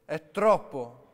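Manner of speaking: loud and drawn-out exclamation; overwhelmed young man crying out; loud outburst. A narrator's reading. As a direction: blurt it out slowly, very loud. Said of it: "commentò ad alta voce".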